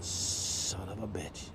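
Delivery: low slow muttered oath; muttered curse. Retold or imitated. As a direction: grumble it to yourself. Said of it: "and I went".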